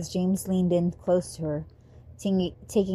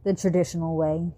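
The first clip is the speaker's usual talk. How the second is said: plain reading voice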